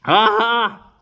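sharp high loud ah; loud pained cry. Real, not acted. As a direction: cry out as the sour bites. Second sip, sourness hits.

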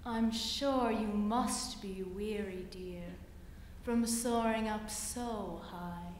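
low, soft coaxing spider voice; sly spider. Acted. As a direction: purr it low and sweet like a spider. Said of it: "said the spider to the fly".

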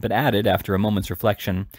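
plain reading voice

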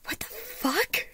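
slow, rising, high-pitched disbelief; stunned. A narrator's reading. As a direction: blurt it out in drawn-out shock. Harriet stunned by the surname.

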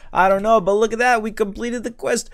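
high, loud cheer building to a shriek; excited celebration. Real, not acted. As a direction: whoop wildly over a big cash win. Gamer celebrates completing quest and money.

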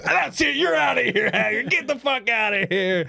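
hammed-up high ejection call; mock ref ejecting player. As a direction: throw him out like a fed-up ref. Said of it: "It's like".

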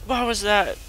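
sharp high startled question. Real, not acted. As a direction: jump at the sound and ask. Startled by a noise.

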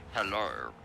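low, deep movie guy hello; cinema guy voice. Retold or imitated. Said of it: "the guy at the cinema where he goes".

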